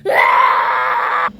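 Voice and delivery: loud, breathy scream; a raw burning-mouth scream; pained scream. Acted. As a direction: cry out in pain. Scream from spicy food.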